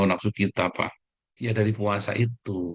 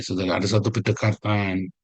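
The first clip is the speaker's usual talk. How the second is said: ordinary talk, nothing marked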